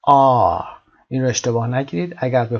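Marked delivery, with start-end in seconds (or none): 0.0-0.6 آ drawn out loud as "ah"; long open آ, R left silent; British R. Shown as a demonstration. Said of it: "صدای ر در انتها نمیشنوید". («آ»ی کشیده و بلند، بدون ر)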